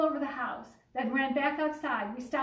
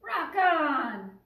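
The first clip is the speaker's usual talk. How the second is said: louder, higher "Rock on"; crew cheer; 4 semitones higher, 7 dB louder than usual. A narrator's reading. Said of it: "cheered the crew".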